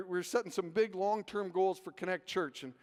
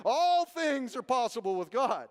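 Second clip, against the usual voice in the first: loud, slow preacher's proclamation; imitated preacher; 5 semitones higher, 7 dB louder than usual. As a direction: proclaim it drawn-out, higher and louder. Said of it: "when he preached one day".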